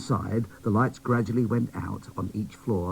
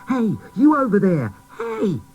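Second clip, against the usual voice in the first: high, loud call across the room; little doll calling to sleeping toys, loud and high; 13 semitones higher, 8 dB louder than usual. Acted. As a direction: shout to wake the others.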